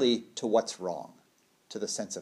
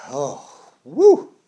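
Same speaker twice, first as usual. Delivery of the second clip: relieved exhale; 2 semitones higher, 11 dB louder than usual. Acted. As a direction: let the breath come back with a big "woo". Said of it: "The breath comes back".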